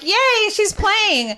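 high, drawn-out gleeful cheer; delighted playful dog. Acted. Podcaster voicing a dog that thinks it's a chase game, gleeful. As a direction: voice an overjoyed dog, stretched out and high. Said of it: "that dog is like".

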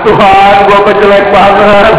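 slow, drawn-out fake crying complaint; mock tearful wail. Preacher mimics a person crying to God over looks, mock tearful. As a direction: wail it slowly in mock self-pity. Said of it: "Ada yang nangis-nangis".

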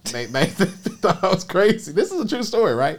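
high, loud laughing speech; amused laughing. Real, not acted. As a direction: laugh through the retelling. Laughing while retelling bank's reaction.

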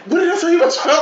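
put-on high laughing scold at the boyfriend; squealing mock scold. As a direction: scold him through laughter. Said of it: "she was like".

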